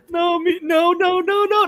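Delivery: high, pleading mock actress voice; a high mock plea of no's; imitating audition. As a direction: squeak out the frantic no's.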